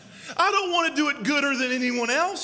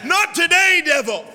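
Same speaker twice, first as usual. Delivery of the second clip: louder defiant cry at the devil; defiant shout; 3 semitones higher, 7 dB louder than usual. Acted. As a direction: hurl it at the devil. Church defying the devil.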